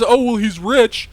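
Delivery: sneering "oh, he's rich"; mocking tone. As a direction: scoff it like a dismissive critic. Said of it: "And was".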